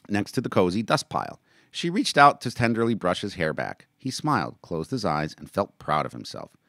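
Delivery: lively read-aloud of a novel; story narration. A narrator's reading. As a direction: narrate the chapter with voiced characters. Reads his novel aloud.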